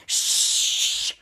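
long, drawn-out "shh"; acted-out shush; urgent shushing. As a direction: hush them so nobody hears. Wife hushes husband.

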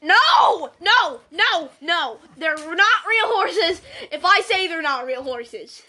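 repeated loud no, stepping down in pitch; slow, loud insistence; stubborn protest. Real, not acted. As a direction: shout each no separately. Refusing over the plot line.

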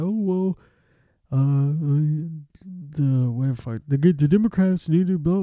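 put-on ranting bystander; mock political griper. Mimicking people arguing about politics.

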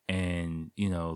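usual speaking voice

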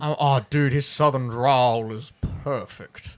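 slow Southern drawl, lightly put on; southern accent. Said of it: "that was a really good impression".

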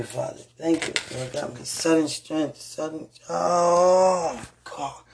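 usual speaking voice

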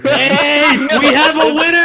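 high, loud cheer; excited. Real, not acted. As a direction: announce it like a game show. Cheering a funny bet.

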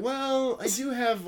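high, soft, hesitant "well"; reluctant hedging. Acted. Torn man weighing his Star Wars figures, reluctant hedging. Imagined wavering over old toys.

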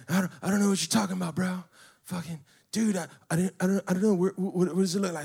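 mock burnout voice, tripping over words; burnout skateboard kid. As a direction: imitate a flustered burnout making excuses. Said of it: "one of them little burnout skateboard kids".

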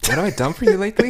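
high, fast mocking echo; high quick retort. Shown as a demonstration. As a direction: fire it back high and quick. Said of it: "Sorry, that was no reaction".

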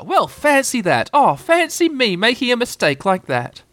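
high, slow, lilting amazement; drawn-out mock astonishment; feigned surprise. A narrator's reading. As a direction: marvel theatrically at your own mistake. Kanga feigns surprise at mistake.

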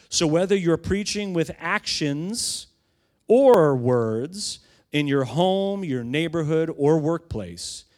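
slow, building preacher's proclamation; emphatic preaching quote. Acted. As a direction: drive the quote home to the congregation, stretching key words. Reads quote's climax in preaching style.

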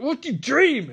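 high, emphatic acted question; mock demanding. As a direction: demand it in exaggerated impatience. Acted interrogation, pressing harder.

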